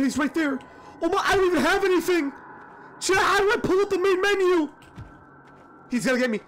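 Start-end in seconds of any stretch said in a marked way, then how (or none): 1.0-4.7 fast, high panicked pleading; panicking gamer. Real, not acted. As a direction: rush the words, rising panic. Monster right there, no weapon.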